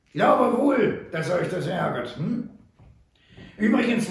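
put-on sly, amused old frontiersman; Sam Hawkins. A narrator's reading. Sam taunts the captured Butler, sly and wry.

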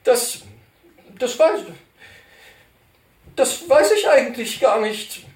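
high, halting stammer. Acted. Ashamed cantor stammering to Poller, high and halting. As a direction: stumble over the words, ashamed. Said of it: "stammelte der Kantor".

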